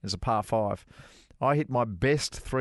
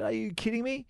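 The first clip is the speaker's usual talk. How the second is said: high, incredulous "are you kidding me"; disbelief; 11 semitones higher, 3 dB quieter than usual. Retold or imitated. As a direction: retell your amazement, pitched well up. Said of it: "He said".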